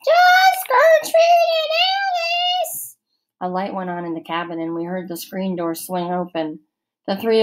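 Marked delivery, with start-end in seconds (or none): 0.0-3.0 very high, slow fake girl's voice; high scratchy voice. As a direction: squeak it out like a girl. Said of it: "in a high, scratchy voice".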